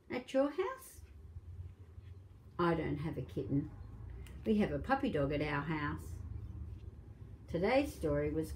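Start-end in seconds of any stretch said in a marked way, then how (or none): none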